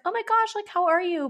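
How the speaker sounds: very high gushing hello; acted excited greeting. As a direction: greet a long-unseen friend, high and gushing. Acts out greeting long-unseen friends.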